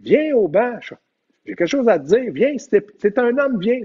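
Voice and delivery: high, pushy call to come over; heated challenge. Retold or imitated. Coach daring the referee to come to the bench. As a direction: dare him to come to the bench.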